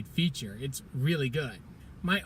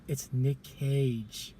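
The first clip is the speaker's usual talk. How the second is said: dramatic reveal; 2 semitones lower, 3 dB quieter, much slower than usual. Real, not acted. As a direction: let the name land slowly. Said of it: "You wouldn't believe it".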